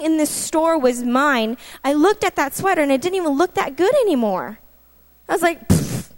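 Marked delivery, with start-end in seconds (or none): 5.7-6.1 airy scoff through the lips; dismissive pfft. Retold or imitated. Shrugging off the sweater she had wanted, dismissive pfft. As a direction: puff of mock disdain.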